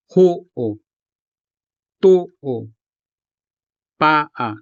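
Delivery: Ho'o, to'o, pa'a with the weight on ho, to, pa; stress on first syllable. Shown as a demonstration. Teacher replaying the words so learners hear first-syllable stress. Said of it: "the stress falls on the first syllable".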